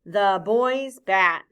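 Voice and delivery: drawn-out choral reading; slow choral repeat. Shown as a demonstration. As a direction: say it together, very slowly. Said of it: "Say that with me".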